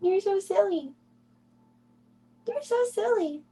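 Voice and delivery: put-on very high cooing baby voice; high baby talk. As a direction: squeal it sweetly up high at the kitten.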